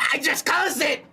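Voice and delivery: exasperated shout. Real, not acted. Reacting to chat after closing.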